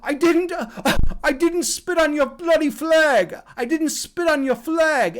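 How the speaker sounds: put-on high, loud, drawn-out English-accented protest; imitated English kid. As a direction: plead innocence as a beaten English schoolboy. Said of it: "He was from England".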